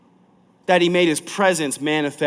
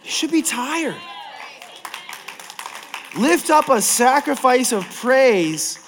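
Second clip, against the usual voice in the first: much higher, calling it out; lifted call; 7 semitones higher than usual. Real, not acted. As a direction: push the repeat up high to the room. Repeated line, whispered then called.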